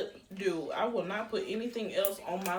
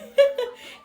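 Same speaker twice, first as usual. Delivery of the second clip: sudden high laugh; embarrassed high giggle; burst of laughter; 9 dB louder than usual. Real, not acted. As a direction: laugh out loud at yourself. Laughing at own odd purchase.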